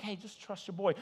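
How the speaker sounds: smug trust-me. Shown as a demonstration. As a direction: hush them with a knowing grin. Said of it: "I wanna be like".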